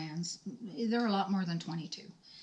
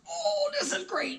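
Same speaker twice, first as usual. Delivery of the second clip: gushing fake delight. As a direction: overdo the joy of receiving a gift. Feigned delight at a present.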